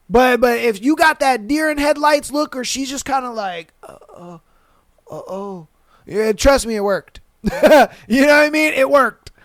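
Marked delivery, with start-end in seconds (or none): 3.8-5.7 faint, stunned stammer; barely voiced, dazed little noises; speechless. Imitating her when it worked, speechless and faint.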